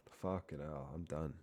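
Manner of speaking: usual speaking voice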